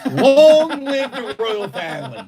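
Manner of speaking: loud, high, drawn-out proclamation; hammed-up herald's cheer; mock royal salute. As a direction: hail the monarchy with a long wobbling flourish.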